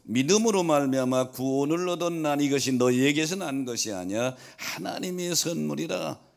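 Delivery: loud, high-pitched preacher's proclamation; full-force preaching. Real, not acted. Preacher proclaiming salvation as God's gift to the congregation, full-force preaching. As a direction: preach it out with driving emphasis.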